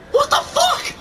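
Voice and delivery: very high-pitched real panic; horrified shriek. Real, not acted. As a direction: shriek it in horror at the monster. Monster reappears in game.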